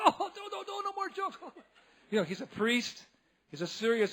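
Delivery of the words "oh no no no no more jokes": much higher, laughing out no more; giggling protest; laughing plea. Retold or imitated. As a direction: beg through laughter. Boys laughing, begging stop jokes.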